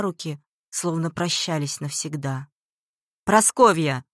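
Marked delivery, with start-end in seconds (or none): 3.2-4.0 loud, commanding call of a name; thundering shout. A narrator's reading. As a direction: boom the name across the village. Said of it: "голос Алексея прогремел". (громовой окрик)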